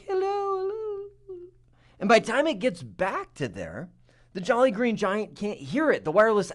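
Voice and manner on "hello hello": very high, soft, slow squeak; tiny mouse voice. Retold or imitated. Teacher voices the little mouse device calling back weakly. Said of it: "this little mouse is like".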